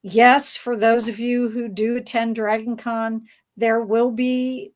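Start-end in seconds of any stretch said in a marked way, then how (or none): none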